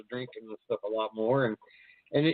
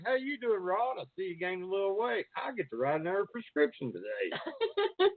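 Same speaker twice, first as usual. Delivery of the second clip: imitating doctor; 7 semitones higher, 3 dB quieter than usual. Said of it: "they're like".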